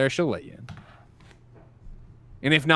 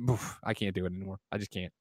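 mock defeated; 8 semitones lower, 13 dB quieter than usual. Mock despair giving up rant.

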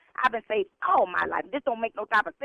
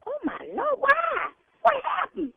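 shocked gasp; 13 semitones higher than usual. Real, not acted. Shocked at pastor news.

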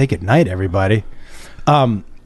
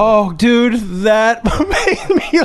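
laughing hard. Real, not acted. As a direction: laugh helplessly. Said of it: "I've never seen somebody laugh so hard".

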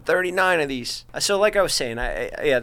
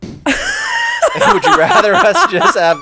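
laughing; 7 dB louder, much slower than usual. Real, not acted. Laughing mid political rant.

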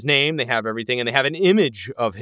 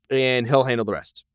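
ordinary delivery

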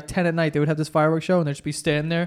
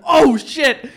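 startled; 8 semitones higher, 5 dB louder than usual. Retold or imitated. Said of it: "I was like".

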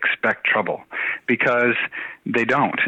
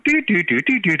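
dial tone; 9 semitones higher than usual. Retold or imitated. Imitating phone dialing tones.

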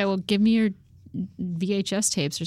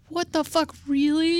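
disbelief; 9 semitones higher than usual. Retold or imitated. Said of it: "and you're like".

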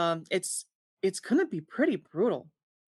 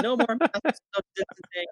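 mock protester shout; 4 semitones higher than usual.

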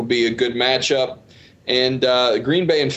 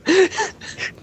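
high burst of laughter; big laugh. Real, not acted. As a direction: crack up laughing. Laughing at parrot bit.

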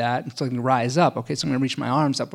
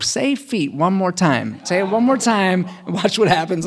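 mock threat; 5 semitones higher, 4 dB louder than usual. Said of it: "I'm like".

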